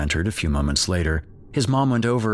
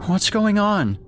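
put-on much higher small boy's voice, a little louder; frightened child; 3 dB louder, slower than usual. Jake asking his parents what's going on, frightened child. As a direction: ask as a scared little boy. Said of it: "Jake asked".

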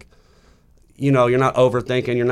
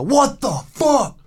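high, drawn-out mock cry of disbelief; freaked-out stranger; 13 semitones higher, much slower than usual. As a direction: imitate a stunned voice from the corner, slow and high. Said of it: "you hear this voice in the corner".

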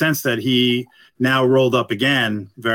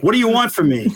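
higher, mock-defensive blurt; mock exasperated New Yorker; 10 semitones higher, faster than usual. To friendly passing drivers, a mock-annoyed New Yorker retort. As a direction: play the wary local who's been greeted. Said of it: "and I'm like".

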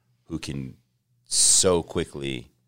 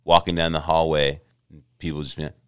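normal talking voice

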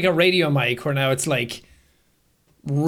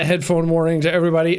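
ordinary talk

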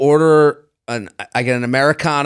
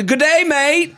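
a light mock Australian accent, pitched higher; 12 semitones higher, slower than usual. Host jokingly greets cohost in a hammed-up Australian accent. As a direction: toss off a jokey Australian accent. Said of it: "try and do an Australian".

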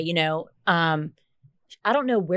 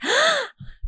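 sharp high gasp of disbelief; real dramatic gasp; shocked gasp. Real, not acted. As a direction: suck in air, scandalized. Shocked reaction to two weeks.

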